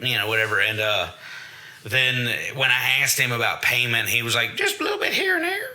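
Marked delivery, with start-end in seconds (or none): 4.6-5.7 much higher squeaky mock voice; impression of trainer. Trainer dodging a question about payment, squeaky high mock voice. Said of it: "that's my impression of him".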